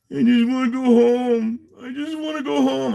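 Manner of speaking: high, whiny imitation of a crying man; mock crying. Mocking the crying guy in the back seat, whiny and high. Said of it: "he was crying".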